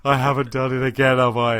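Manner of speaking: a touch of guilty panic; mock dismay. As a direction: play it as a half-laughing groan of realisation. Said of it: "and he's like".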